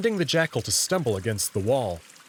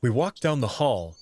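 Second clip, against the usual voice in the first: plain reading voice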